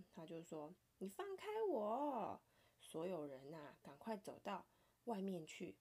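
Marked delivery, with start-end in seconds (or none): 1.0-2.4 high, loud cry of let go; an angry bread yelling to be let go; sharp, loud storybook shout. A narrator's reading. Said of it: "面包好生气哦".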